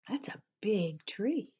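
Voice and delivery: whisper. Real, not acted. Whispered aside.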